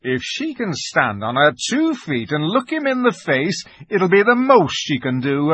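exultant beaver, high and emphatic; rising, rousing confidence in a character voice; Mister Beaver. A narrator's reading. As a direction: declare total faith in the king.

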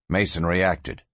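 ordinary delivery

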